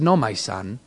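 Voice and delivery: very low, hushed correction; deep, quiet, measured reply; voice of Jesus. Retold or imitated. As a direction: answer softly and gravely, pausing between phrases. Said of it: "And he said".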